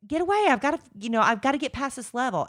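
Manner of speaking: high, pressured retold snap; mock-frantic mom shooing her child; brushing kid off. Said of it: "pushed my kid away".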